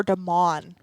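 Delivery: "Demon" as duh-MON, stressed ending; third name variant. Shown as a demonstration. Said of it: "We get that one".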